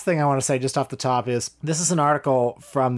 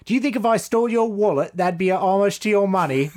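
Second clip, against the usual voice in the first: Eric Idle impression; 7 semitones higher than usual. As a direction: lash out in a mock British voice. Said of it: "I can't really do an Eric Idle voice".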